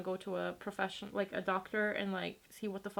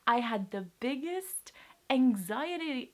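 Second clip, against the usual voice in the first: voice breaking; 3 semitones higher, slower than usual. Real, not acted. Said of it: "I can't speak".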